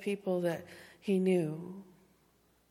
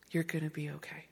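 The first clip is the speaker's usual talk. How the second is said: whispered through tears, much softer; tearful whisper; 5 dB quieter, faster than usual. Real, not acted. Pastor reassuring the congregation, whispering while crying. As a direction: breathe "You're gonna be okay" as a real crying whisper. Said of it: "and now I am".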